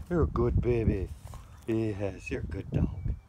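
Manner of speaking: high loud doting praise for a pet. Real, not acted. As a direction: gush over the dog in a sing-song voice. Baby talk praising dog.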